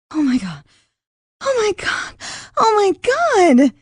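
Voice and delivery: building from a gasp to a high, loud shriek; terrified scream. Acted. J C sees the half-shifted cousin, rising terrified screams. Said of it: "she screamed".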